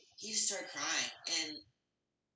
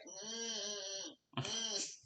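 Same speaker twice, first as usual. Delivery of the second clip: absent mm, mm; vacant hums; 4 semitones higher, 4 dB quieter, much slower than usual. Retold or imitated. A foggy wife humming back at her husband. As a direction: hum along with nothing to say. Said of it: "so I just be like".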